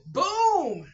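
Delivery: high, loud punchy boom; the chicken's flavor hitting like a blow; emphatic boom. Pretend. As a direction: punch it out like an impact. Chicken flavor punching her body.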